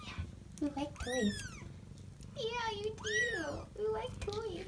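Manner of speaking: high, soft, slow coo to the kitten. Real, not acted. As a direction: sing it gently up high to a kitten. Cooing to kitten with toys.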